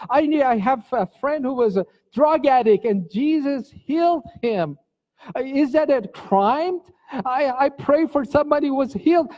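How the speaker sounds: high indignant protest; retold shouting. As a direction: cry out your innocence at the police. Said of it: "I started shouting in police station".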